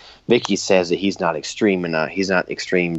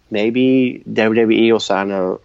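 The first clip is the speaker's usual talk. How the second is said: ordinary delivery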